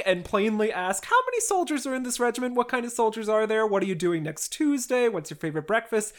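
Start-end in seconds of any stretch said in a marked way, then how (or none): 1.1-6.1 quick string of brisk questions; rapid-fire questioning. Retold or imitated. Dolokhov pumps the French for information, rapid-fire. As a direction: fire off the questions fast, starting higher.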